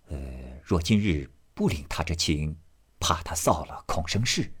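soft murmured private reasoning; inner thoughts. Acted. Jia Yun silently weighs accepting the loan, soft and murmured. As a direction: think it over quietly to yourself. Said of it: "贾云心下自私".

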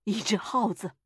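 put-on old man's slightly trembling answer. A narrator's reading. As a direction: answer with a touch of fearful tremble. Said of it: "声音发颤". (声音微微发颤)

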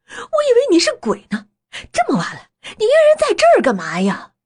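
high, loud, rattled exclamation; startled passer-by. Acted. Passer-by to old man in the graveyard at night, startled. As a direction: blurt it out high and loud after a scare.

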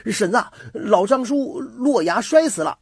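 high-pitched, hurried shout of alarm; frantic neighbour calling; urgent calling out. A narrator's reading. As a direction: cry out the bad news as you come running. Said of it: "急匆匆地跑来喊道".

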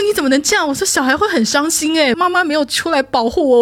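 playful telling-off; mock scolding. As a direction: scold her half laughing. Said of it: "我就大骂他".

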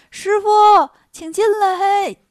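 high, loud, drawn-out call. Acted. Wukong calls his master in from outside, shouting. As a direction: holler to someone outside. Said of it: "孙悟空喊".

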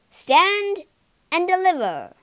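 high, loud highwayman-style challenge; commanding shout. Acted. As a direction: call it out loud to halt someone. Horse rider blocks the villain's way.

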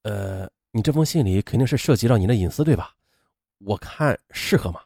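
low, soft, tentative questioning; hesitant. A narrator's reading. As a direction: hesitate gently over reading something private. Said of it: "郑东进却犹豫着道". (犹豫地低声说)

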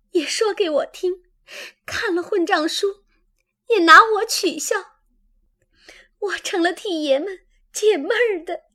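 high-pitched, weeping complaint in broken phrases; tearful reproach. A narrator's reading. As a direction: protest through tears, voice pitched high. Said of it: "黛玉便哭道".

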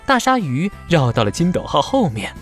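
plain reading voice

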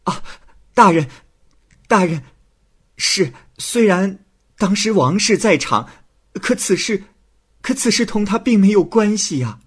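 put-on high, panicked girl's plea; frightened girl. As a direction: squeal out her fear to the judge. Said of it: "胭脂一害怕". (胭脂惊慌求饶，声音尖高)

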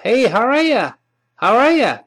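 "how are you" said as "howarya"; high, stretched greeting with "you" reduced to "ya"; weakened vowel. Shown as a demonstration. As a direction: run "how are you" together, ending in "ya". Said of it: "就变成".

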